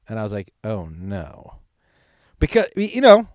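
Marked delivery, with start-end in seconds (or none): 0.6-1.6 dreading. Retold or imitated. Dad dreading the reveal.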